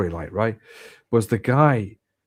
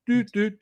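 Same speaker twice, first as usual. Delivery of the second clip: high mock keypad tones, pitched way up; imitated keypad beeps; 10 semitones higher than usual. Pilot locked out of the cockpit, mock keypad beeps. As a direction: sing out the door code beeps.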